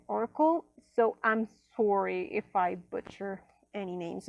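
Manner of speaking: ordinary delivery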